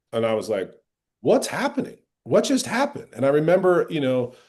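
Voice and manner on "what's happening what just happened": high, bewildered what's happening; sudden disbelief, pitched way up; retelling a stunned reaction to critique; shocked. Retold or imitated. Shocked reaction in retold scene.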